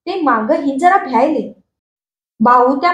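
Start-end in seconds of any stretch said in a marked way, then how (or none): none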